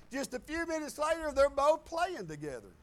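normal talking voice

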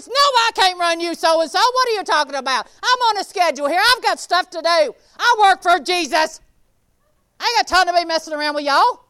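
loud, exasperated brush-off; impatient refusal. Acted. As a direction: snap back loud, no time for this.

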